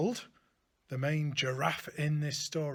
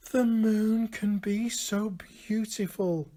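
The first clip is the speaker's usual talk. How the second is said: ordinary delivery, nothing marked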